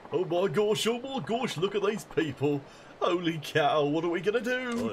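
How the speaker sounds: high, hammed-up fluster; panicked game character. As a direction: fret loudly in a high put-on voice.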